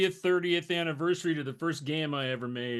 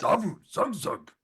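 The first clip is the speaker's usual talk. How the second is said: orc grunts; 4 semitones higher than usual. Shown as a demonstration. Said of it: "the famous phrase".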